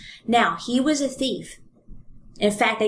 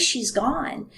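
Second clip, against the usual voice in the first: normal talking voice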